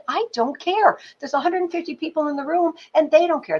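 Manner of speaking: exasperated outburst. Retold or imitated. Teacher to rambling student, exasperated outburst. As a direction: snap at the student to stop listing. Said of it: "I said".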